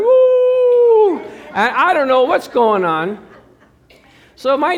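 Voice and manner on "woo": high, loud howling woo; dog howl. Retold or imitated. Neighborhood dogs joining in, loud howl. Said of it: "wake up their dog friends".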